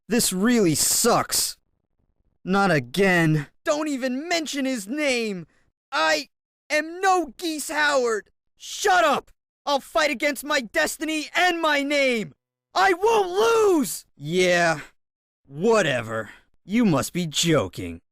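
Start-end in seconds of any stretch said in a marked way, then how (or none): 3.6-14.1 rising, defiant cry against fate; defiant outburst. Acted. Fighter refusing to be his father's son, defiant cry.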